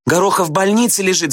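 high-pitched schoolgirl outcry; girls shouting. A narrator's reading. Schoolgirls correct the teacher, shouting. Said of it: "закричали девицы".

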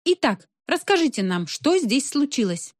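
a hint of an eager journalist; interviewing reporter. A narrator's reading. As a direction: put the question like a reporter on air. Reporter starts interviewing a witness.

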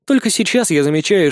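ordinary delivery

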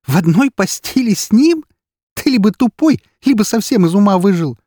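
very high, loud outburst; mocking incredulity. A narrator's reading. Эри mocks the neighbour's assumption, loud and incredulous. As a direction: ridicule the idea in a high, scornful voice. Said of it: "сквозь смех проговорила она".

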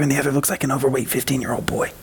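ordinary delivery

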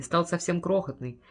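usual speaking voice

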